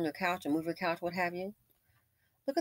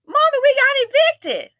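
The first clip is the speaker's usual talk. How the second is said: high, loud wail of a mock kid; imitated child; 13 dB louder than usual. Kid to mommy on eviction day, high loud mock wail. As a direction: play a staged little kid crying out. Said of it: "the kid comes out there ... and says".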